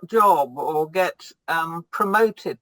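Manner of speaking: ordinary delivery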